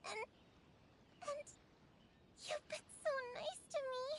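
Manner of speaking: normal talking voice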